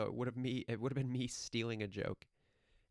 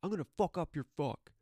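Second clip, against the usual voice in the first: loud, higher-pitched mock threat; hammed-up tough talk; imitated angry threat; 6 semitones higher, 6 dB louder than usual. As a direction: act out a riled comeback. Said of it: "he goes".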